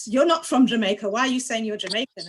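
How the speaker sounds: normal talking voice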